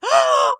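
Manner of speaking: imitated high gasp of alarm; shocked gasp. Bank tellers fearing a robbery, imitated shocked gasp.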